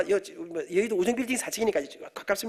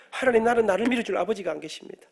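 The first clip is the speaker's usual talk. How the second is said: ordinary talk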